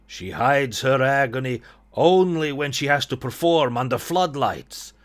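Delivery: put-on slow, courteous Eastern European accent; Ilya, Eastern European accent. Brother hosts the painter in dim light, Eastern European accent. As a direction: play a formal host with a loose Eastern European accent. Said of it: "I don't know what accent I'm doing here".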